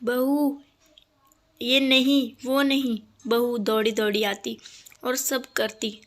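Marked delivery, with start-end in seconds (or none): 0.0-3.0 shouting. A narrator's reading. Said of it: "सास चिल्लाती".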